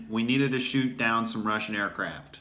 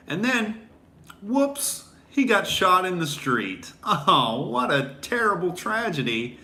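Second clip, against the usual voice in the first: mock innocent sarcasm; 7 semitones higher than usual. Sarcastic retelling of a death.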